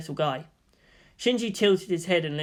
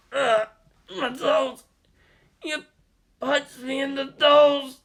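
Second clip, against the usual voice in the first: higher, slow pained whine; 8 semitones higher, slower than usual. A narrator's reading. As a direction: whimper from the stubbed toes. Toji hurt after hitting.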